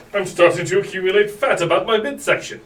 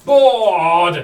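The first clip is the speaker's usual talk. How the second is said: loud bored whine; 10 dB louder than usual. Acted. Whiny repeated complaint.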